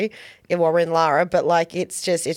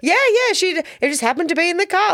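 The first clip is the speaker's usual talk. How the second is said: mock apologetic excuse; 10 semitones higher than usual. Amy excusing Mum's turkey to the hosts, imitated high-pitched apology. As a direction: imitate someone hastily explaining away a blunder, pitched way up. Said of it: "she's trying to be like".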